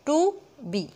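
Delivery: "टू" strong, "बी" let drop; emphatic "टू", unstressed "बी"; stress on टू. Shown as a demonstration. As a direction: lean on "टू", pause, then a lighter "बी". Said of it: "बी के ऊपर कोई stress नहीं".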